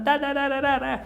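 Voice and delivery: high-pitched nonsense syllables imitating a tirade; mock screaming rant. Customer cutting off the polite shop man, mock ranting. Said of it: "and I'm screaming".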